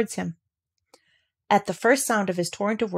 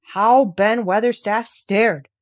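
usual speaking voice

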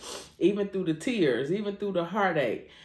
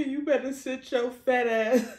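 imitating bossy mom; 6 semitones higher than usual. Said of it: "that's just how she talks".

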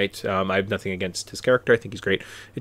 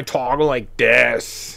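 slow, put-on whine, slightly raised; Chunk's whiny talk; much slower than usual. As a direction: imitate a whiny kid dragging out the words. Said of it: "he's kind of whiny".

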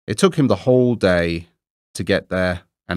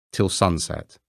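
plain reading voice

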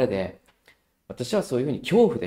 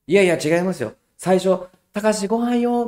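sweet call; 8 semitones higher, 6 dB louder than usual. Retold or imitated. Mother defending herself, sweet call.

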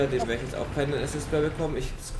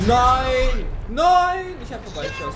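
high, loud cry of frustration; dismayed shout; 12 semitones higher, 9 dB louder, slower than usual. Real, not acted. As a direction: yell in despair at missing. Missed the shot, dismay.

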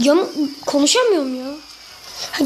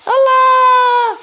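higher, louder cry of frustration; excited shout; 5 semitones higher, 4 dB louder, much slower than usual. Real, not acted. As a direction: shout it at the screen. Gamer reacts to in-game death. (oyunda heyecanla bağırarak)

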